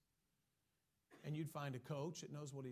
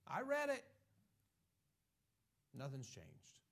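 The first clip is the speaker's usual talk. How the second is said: mock whine; 4 dB louder than usual. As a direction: whine then sag. Imitating complaining churchgoer.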